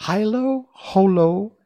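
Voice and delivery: high, loud incantation; ringing magic words, spaced apart; reciting magic charm. A narrator's reading. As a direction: call out the spell word by word.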